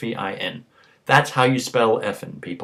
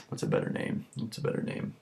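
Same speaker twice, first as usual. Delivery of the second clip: whisper; 12 dB quieter, faster than usual. Real, not acted. Muttered to self.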